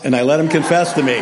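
ordinary delivery

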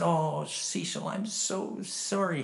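soft, slow, drawn-out condolence; sorrowful sympathy. Retold or imitated. Preacher to his dying friend, softly sorrowful. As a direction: draw it out gently with sorrow.

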